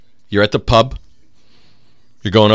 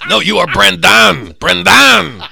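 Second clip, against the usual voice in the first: louder, slower fake-accent insistence; a hint of Russian accent on "Brendan"; mock Russian correction; 6 semitones higher, 8 dB louder, much slower than usual. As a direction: correct him like a stern handler. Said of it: "correcting fake name, accented".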